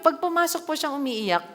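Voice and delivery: usual speaking voice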